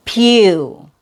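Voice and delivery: "pew" stressed, with a long U; strong syllable. Shown as a demonstration. Teacher showing the stressed syllable of computer, strong. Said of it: "it's a strong syllable".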